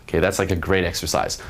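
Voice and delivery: ordinary talk, nothing marked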